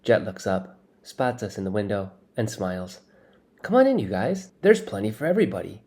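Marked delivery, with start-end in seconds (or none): 3.6-5.8 high, loud breezy welcome; cheerful host. Acted. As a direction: call them in cheerfully. Smug host invites them in.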